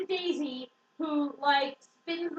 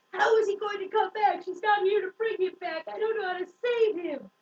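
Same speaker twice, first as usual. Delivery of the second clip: loud, high, whiny tantrum imitation; mock tantrum; 6 semitones higher, 4 dB louder than usual. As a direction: wail it like a hammed-up meltdown. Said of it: "like, tantrum".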